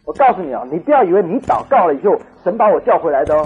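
loud, emphatic acted scolding; angry husband. Husband scolding his praying wife, acted loud anger. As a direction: tell her off forcefully, as in a retelling. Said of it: "她丈夫很生气".

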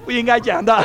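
high, loud through laughter; real amused aside while laughing. Real, not acted. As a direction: admit it through giggles. Laughs at own joke.

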